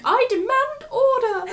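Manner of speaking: high, loud, drawn-out mock demand; Umbridge. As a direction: play a bossy official demanding order. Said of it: "she would just be like".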